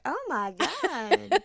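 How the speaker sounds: slow laughing groan. Retold or imitated. Wife laughing at her husband's tipping. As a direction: laugh the words out slowly.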